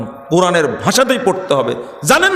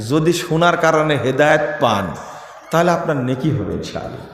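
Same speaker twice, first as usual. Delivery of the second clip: ordinary talk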